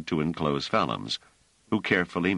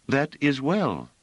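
put-on higher, slow, clear young woman's voice; clear soprano; 10 semitones higher, slower than usual. A narrator's reading. Hiroko answering the strangers, clear high soprano. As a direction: sing it out lightly like a soprano. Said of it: "in her clear soprano".

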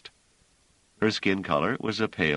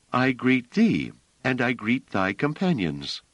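Hiroko, formal and clear; 8 semitones higher than usual. A narrator's reading. Hiroko greeting the spaceship crew, high and formal. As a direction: greet the strangers with old-fashioned grace.

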